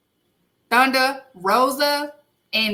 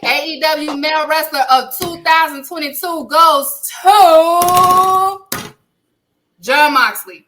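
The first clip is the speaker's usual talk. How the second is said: big announcement; 4 semitones higher, 6 dB louder than usual. Acted.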